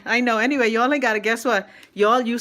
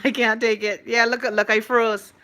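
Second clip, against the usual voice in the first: usual speaking voice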